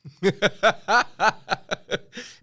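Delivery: a loud, high burst of laughter. Real, not acted. Host cracking up at the improv story, loud burst.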